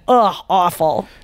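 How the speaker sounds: usual speaking voice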